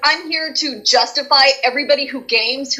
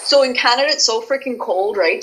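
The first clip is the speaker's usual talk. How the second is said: ordinary talk, nothing marked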